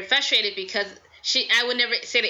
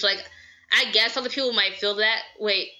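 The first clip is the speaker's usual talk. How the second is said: ordinary delivery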